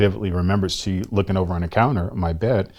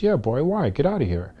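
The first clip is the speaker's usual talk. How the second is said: higher, dismissive big-sister voice; 8 semitones higher than usual. Retold or imitated. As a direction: shoo him away as his sister. Sister brushes him off.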